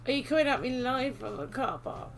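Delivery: ordinary talk, nothing marked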